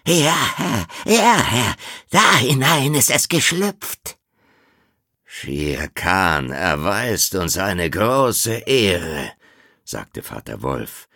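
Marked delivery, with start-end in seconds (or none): 0.1-4.2 high, loud squawking jackal; honking voice. Acted. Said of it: "Hinter ihm quäkte Tabaqui". 5.2-9.3 slow, icy politeness over hidden fury; measured words through contained rage; restrained anger. Acted. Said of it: "seine Augen funkelten vor Zorn".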